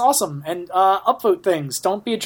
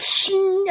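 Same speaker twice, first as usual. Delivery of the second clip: gleam sound effect. Pretend. Imitating a visor gleam.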